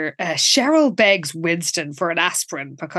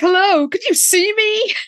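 mock indignant; 10 semitones higher than usual. Voicing ignored Lila demanding attention.

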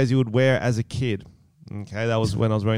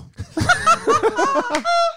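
high burst of laughter. Real, not acted. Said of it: "laughs at own attempt".

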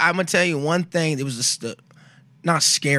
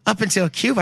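amused disbelief; 6 semitones higher than usual. Retold or imitated. Phil answering his sister's prophecy, high amused disbelief. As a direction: push back, half laughing.

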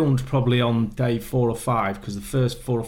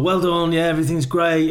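mock upbeat praise; 5 semitones higher, 4 dB louder than usual. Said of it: "he's all".

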